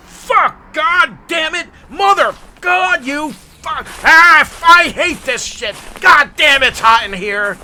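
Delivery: high loud angry swearing; behind-the-scenes cursing. Retold or imitated. Santa sweating behind the scenes, angry cursing. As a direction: rage at the heat behind the camera. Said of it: "Santa's there going".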